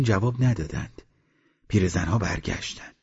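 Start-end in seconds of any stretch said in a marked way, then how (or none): none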